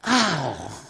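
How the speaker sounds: a pained little "ow" of frustration; half-joking cry of frustration; frustrated ow. Real, not acted. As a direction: groan it out like a small sting. Frustrated with failing to meditate.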